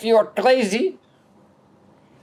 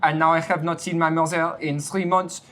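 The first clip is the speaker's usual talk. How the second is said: a hammed-up French accent, zis for this. Little French boy mixing a sponsor cocktail, in a hammed-up French accent. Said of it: "Little French boy, take it over".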